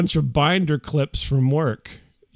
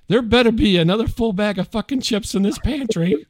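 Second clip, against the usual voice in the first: mock angry wife; 7 semitones higher, 3 dB louder than usual. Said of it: "She's like".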